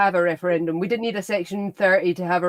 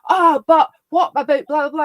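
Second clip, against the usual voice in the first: mock opponent; 7 semitones higher, 7 dB louder than usual. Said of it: "I'm like".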